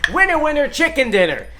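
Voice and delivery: loud, high game-show bell and cheer; excited celebration. Real, not acted. Host celebrating his right guess, loud game-show cheer. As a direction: ring it in like a game-show win.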